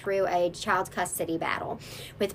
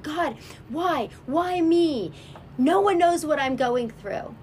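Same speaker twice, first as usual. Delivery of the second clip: higher, desperate cry to God; anguished plea; 5 semitones higher, slower than usual. Retold or imitated. Alone and hurting, she cries out to God in anguish. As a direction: plead with God, voice lifted in pain. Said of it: "I, I said".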